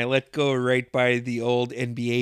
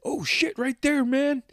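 excited spotting; 11 semitones higher than usual. Retold or imitated. Johnny spotting a car, retold.

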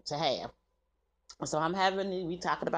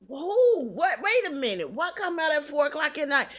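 high, loud mock shock, fast at the end; shocked disbelief; 8 semitones higher, 6 dB louder than usual. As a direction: replay your stunned reaction to the sister. Retells her own shocked reaction.

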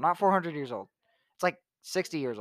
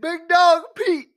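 higher, louder, very slow big cartoon dog; Pete voice; 9 dB louder, much slower than usual. Friends riffing on Pete, slow drawn-out cartoon dog voice. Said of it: "That's basically just Pete".

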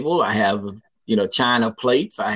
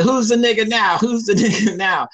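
gloating taunt; 7 semitones higher than usual. Retold or imitated. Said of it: "I said".

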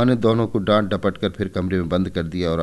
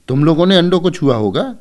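higher, louder accusing mother; angry mother; 10 semitones higher, 9 dB louder than usual. A narrator's reading. Mother sees the broken eggs, angrily accuses the children. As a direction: demand if they touched the eggs. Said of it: "गुस्से से बोली".

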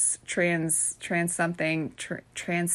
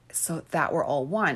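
normal talking voice

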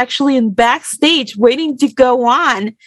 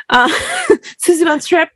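laughing; 7 semitones higher than usual. Real, not acted. Laughing at a rival's name.

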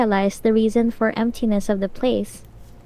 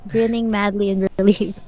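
plain reading voice

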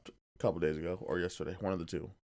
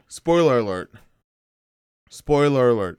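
announcing loudly; 2 semitones higher, 9 dB louder than usual. Real, not acted. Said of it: "I gotta say it a couple times".

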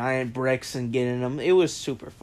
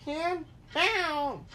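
high mocking echo of the teacher's order; mock strict teacher; 14 semitones higher than usual. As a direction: parrot the teacher in a sing-song sneer.

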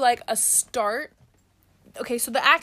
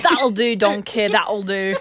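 loud Scottish Shrek line, laughing; hammed-up Scottish "That'll do, donkey"; loud mock Scottish, breaking into laughs; Scottish accent; 7 dB louder, slower than usual. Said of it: "the only thing I can say in Scottish".

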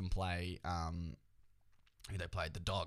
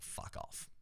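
whispered curse; 10 dB quieter than usual. Retold or imitated. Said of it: "I'm also like".

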